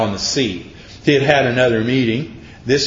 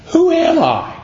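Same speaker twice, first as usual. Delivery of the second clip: much higher, louder, ringing question; emphatic question; 4 dB louder than usual. Retold or imitated. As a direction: throw the question out to the whole room. Preacher voicing Jesus, peak.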